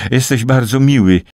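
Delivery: grudging politeness, dry. A narrator's reading. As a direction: compliment him coolly, as a formality. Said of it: "Jej głos brzmiał sucho".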